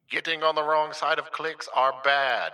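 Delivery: loud, high megaphone announcement. Acted. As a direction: blare it like through a megaphone. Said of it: "I broke out the megaphone voice effect for that one".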